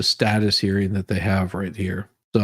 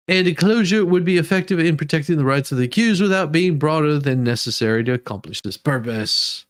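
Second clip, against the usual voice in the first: reading the motion aloud, pitched up and steady; formal legal read; 5 semitones higher than usual. Acted. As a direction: recite the filing like a court clerk. Reads court motion aloud.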